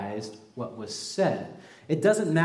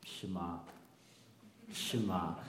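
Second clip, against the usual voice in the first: soft, low, simple yes; obedient reply; 7 semitones lower, 11 dB quieter, slower than usual. Retold or imitated. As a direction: answer quietly, ready to obey. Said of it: "You would just be like".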